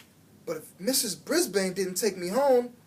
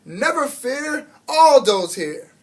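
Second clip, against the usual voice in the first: slow, booming, much louder heroic call; roared; 6 semitones higher, 9 dB louder, slower than usual. A narrator's reading. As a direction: announce yourself like a hero arriving. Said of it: "a familiar voice roared".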